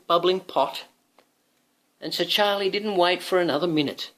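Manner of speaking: plain reading voice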